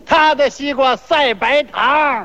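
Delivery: slow, stretched street-seller call; mock vendor's cry. Seller praising the other's melon in turn, drawn-out vendor's cry. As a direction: draw the words out to hawk them. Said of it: "互相吹".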